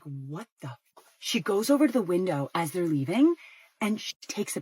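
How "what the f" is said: low, hushed disbelief; baffled aside. Retold or imitated. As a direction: trail off under your breath. Baffled mutter after couple leaves.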